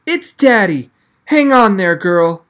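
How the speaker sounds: fighting back happy tears while cheering her on; choked with emotion. Acted. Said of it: "his voice choked with emotion".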